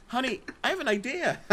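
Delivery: high, amused call to a spouse; householder struck by idea. Acted. Householder calling to spouse about insurance, mock inspired. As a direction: pitch it way up, laughter behind it. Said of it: "It's like".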